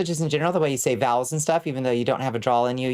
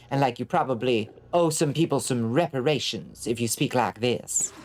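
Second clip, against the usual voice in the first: posh Savannah accent. Shown as a demonstration. Demonstrating to chat how moneyed Savannah sounds, posh Southern accent. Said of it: "this posh Southern accent".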